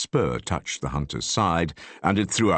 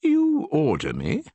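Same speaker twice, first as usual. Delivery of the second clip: polite inquiry; 10 semitones higher than usual. A narrator's reading. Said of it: "inquired politely".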